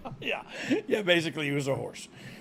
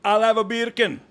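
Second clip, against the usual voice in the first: louder hammed-up Jamaican accent; mock Jamaican accent; 5 semitones higher, 7 dB louder than usual. Goofing on a podcast, he orders bacon in a mock Jamaican accent. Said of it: "saying bacon in Jamaican".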